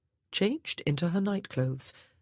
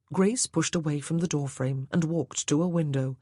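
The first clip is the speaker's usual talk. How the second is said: ordinary delivery, nothing marked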